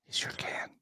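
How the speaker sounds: hushed whisper in a dark theater; movie theater whisper. Shown as a demonstration. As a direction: whisper it so nobody hears. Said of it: "you can whisper in the movies".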